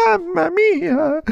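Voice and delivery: grido acuto e lento; spaventato. Acted. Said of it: "gridò spaventato". (burattino spaventato, grido acuto)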